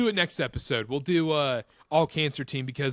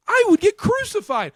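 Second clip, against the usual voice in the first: exclaimed; 7 dB louder than usual. Real, not acted. Hypothetical outrage at himself.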